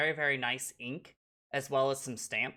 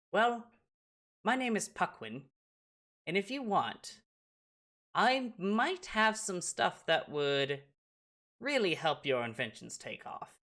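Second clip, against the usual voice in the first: high-pitched gnome, measured offer; lightly put-on high voice, coming down at the end; friendly gnome inventor; 6 semitones higher than usual. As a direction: tempt the young inventor in a higher voice. Gnome introduces himself, makes an offer.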